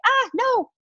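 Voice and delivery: high-pitched squeal of refusal; mock panicked, high-pitched refusal; mock dismay. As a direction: imitate a reluctant client squealing in dismay. Acting out reluctant clients refusing to post.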